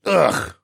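strained grunt getting up; old man grunt. Acted. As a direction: grunt with effort. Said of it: "Tovish grunted".

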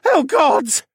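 very high, loud cry to heaven; desperate plea. A narrator's reading. Assassin prays to the gods, pleading. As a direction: plead in terror. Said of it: "Dolian pleaded".

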